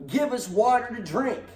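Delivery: lightly voiced whining people; demanding crowd. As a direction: whine the demand at Moses. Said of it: "they demanded".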